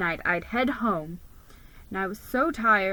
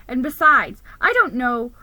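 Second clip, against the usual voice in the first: louder, flustered narrator; anxious outburst; 3 semitones higher, 8 dB louder than usual. A narrator's reading. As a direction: blurt it out nervously.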